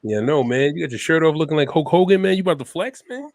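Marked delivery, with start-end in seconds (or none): none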